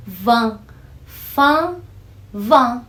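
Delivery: Vin and fin said clearly with the nasal an; nasal vin drill. Shown as a demonstration. Teacher drilling vin for students. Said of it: "Esse fã do an maçã aí é o do vinho".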